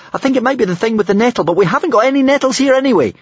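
fast, high-pitched rush of words; panicked babble. A narrator's reading. Hiccup admits his doubt in danger, babbling fast. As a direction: gabble it high and quick.

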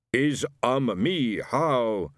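lightly voiced fake spirit guide, hesitant; a hint of a put-on Geronimo; spirit guide answering. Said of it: "she replied".

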